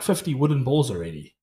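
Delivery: usual speaking voice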